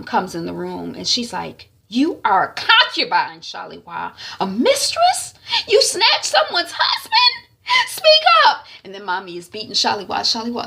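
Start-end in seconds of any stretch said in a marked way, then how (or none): 1.9-8.7 high, loud scolding building to a shriek; outraged mother. Retold or imitated. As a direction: berate her as a furious mother. Mother confronts daughter.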